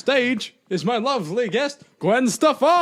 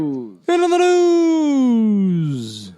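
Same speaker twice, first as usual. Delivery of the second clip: very slow, louder, stretched-out news catchphrase; Keemstar impression; 4 dB louder, much slower than usual. Cueing his friend, very slow stretched Keemstar catchphrase. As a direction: drag it out like a hyped news host. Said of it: "That was a Keemstar joke".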